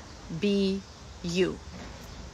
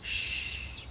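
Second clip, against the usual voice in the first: parent's shush; 4 dB quieter than usual. Retold or imitated.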